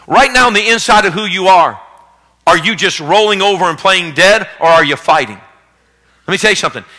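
loud, driving sermon build; rally the congregation to fight; fiery preaching. Acted. Pastor urging the church to fight, preaching hard.

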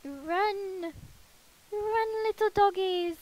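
high-pitched call to pets. Pretend. Player calling the pets home, high playful call.

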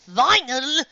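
loud high exasperated cry; exasperated outburst. Acted. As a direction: snap out the name.

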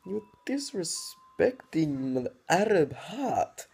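slow, hammed-up wounded whine; mocking high voice. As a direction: squeak it like an overdramatic victim. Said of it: "I'm like".

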